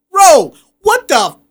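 high, loud, startled cry; shocked coach outburst. Retold or imitated. Coach turning around, shouting in high shocked disbelief. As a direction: blurt it out in disbelief. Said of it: "Craig turns around and goes".

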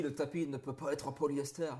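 put-on French with an American accent; Saito's line in an American accent. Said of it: "tu l'as fait avec un accent américain".

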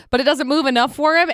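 usual speaking voice

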